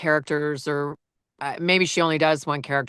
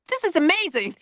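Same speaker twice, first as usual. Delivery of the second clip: much higher, eager voice; mimicking excited husband; 14 semitones higher than usual. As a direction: gush like a husband bursting with an idea. Wife mimics husband's excited praise.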